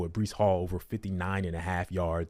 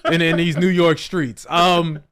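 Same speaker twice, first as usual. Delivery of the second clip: excited; 9 semitones higher, 8 dB louder than usual. Real, not acted. Excited laughing banter.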